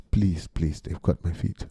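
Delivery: put-on low, soft plea in an African accent; mock-pitiful bear with an African accent. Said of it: "an African accent".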